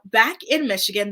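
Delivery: ordinary talk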